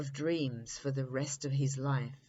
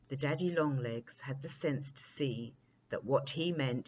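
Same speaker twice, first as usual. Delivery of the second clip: ordinary delivery, nothing marked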